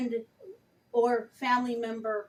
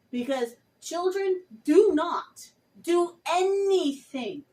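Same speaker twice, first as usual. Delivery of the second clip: passionate emphasis; 5 semitones higher, 6 dB louder, slower than usual. Real, not acted. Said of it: "I've been a little bit passionate".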